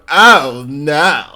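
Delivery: loud, drawn-out mock dismay; big comic "oh, no"; catchphrase overreaction. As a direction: imitate the dramatic friend. Performs friend's catchphrase for joke.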